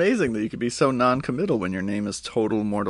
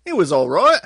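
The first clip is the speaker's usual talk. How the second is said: higher, louder shrugging verdict; mock reviewer; 6 semitones higher, 7 dB louder than usual. Friend parodies the reviewer's verdict, higher and louder. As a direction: toss it off like the indifferent reviewer. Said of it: "He's just like".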